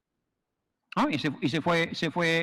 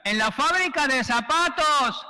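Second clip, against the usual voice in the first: shouted answer; 9 semitones higher, slower than usual. Retold or imitated. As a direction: yell it back up to the bridge. El ahogado le grita. (grito agudo y lento desde el río)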